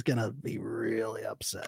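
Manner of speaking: whisper. Real, not acted. Joking warning, hushed.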